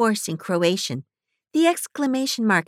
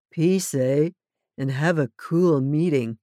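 mellow hippie; 6 semitones lower than usual. A narrator's reading. Tall hippie greets the newcomers in a mellow voice. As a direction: lean back into easy hippie cool.

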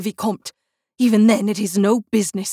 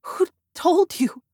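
higher, startled, a touch shaky with fear; frightened stammer; 9 semitones higher than usual. A narrator's reading. Christine, exposed, stammers to Raoul in fear. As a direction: caught out, she gasps the question.

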